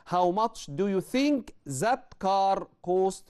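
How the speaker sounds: very slow, deliberate reading; slow model answer. Shown as a demonstration. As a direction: read the right answer slowly. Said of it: "ال correct choice".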